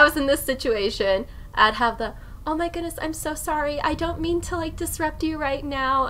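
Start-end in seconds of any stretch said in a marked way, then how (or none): 2.5-6.1 higher, apologetic small voice; polite high voice. Retold or imitated. Streamer showing her voice with strangers, higher and apologetic. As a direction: go up into your nervous polite voice. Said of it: "And I'd use that voice".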